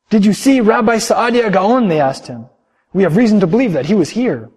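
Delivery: hot on his trail; eager pupils. Acted. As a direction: pupils hunting for their rabbi. Pupils searching for rabbi.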